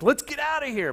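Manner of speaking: high-pitched, hurried-away mock quote; mock ditching folks. Jesus supposedly ditching folks, mock eager escape. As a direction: toss it out high as if bailing on the crowd. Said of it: "he's just gonna be ditching folks".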